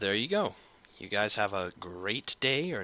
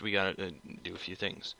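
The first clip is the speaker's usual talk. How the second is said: usual speaking voice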